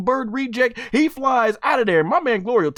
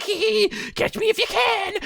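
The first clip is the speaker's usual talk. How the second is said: high, teasing giggle and taunt; taunting villain giggle; 12 semitones higher than usual. Retold or imitated. Voicing the thief taunting Goku, high mocking giggle. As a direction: sing it up high like a cheeky thief running off. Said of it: "the Big Bird's like".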